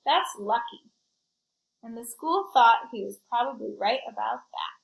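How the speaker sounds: put-on lively read-aloud for kids; story narration. A narrator's reading. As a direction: tell it like a picture book to a class. Reads picture book to children.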